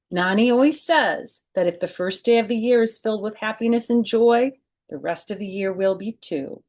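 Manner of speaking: lively read-aloud storytelling voice; children's book narration; story narration. Acted. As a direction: read it to kids, bright and clear. Reads picture book aloud.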